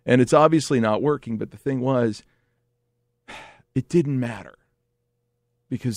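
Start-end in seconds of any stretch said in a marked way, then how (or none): none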